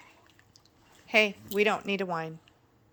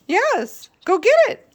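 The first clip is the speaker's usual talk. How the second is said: much higher, louder squeal of encouragement; squealy dog talk; 14 semitones higher, 11 dB louder, slower than usual. Real, not acted.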